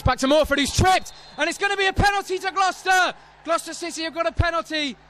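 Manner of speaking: high-pitched, urgent penalty call; excited commentary. Real, not acted. Commentator calling a penalty for Gloucester, excited. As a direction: rise sharply into a sustained high cry.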